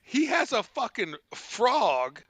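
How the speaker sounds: ordinary delivery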